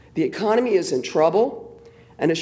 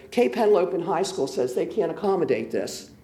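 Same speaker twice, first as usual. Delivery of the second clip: ordinary talk, nothing marked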